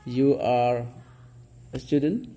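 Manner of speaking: "You are a student" rising on "student"; question intonation. Shown as a demonstration. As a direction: ask "You are a student" in statement word order. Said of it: "ucapannya seperti bertanya".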